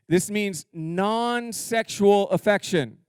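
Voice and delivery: non-sexual said slowly and heavily; slow, deliberate stress; slow emphasis. Shown as a demonstration. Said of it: "Let me say that one more time".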